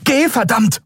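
high, pressing command; captain urging Kai to run; urgent order. A narrator's reading. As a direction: push him out the door.

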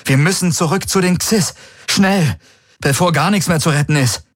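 high, pressing appeal; urgent plea. A narrator's reading. Garland begs the commander to turn back, urgent plea. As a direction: plead before time runs out.

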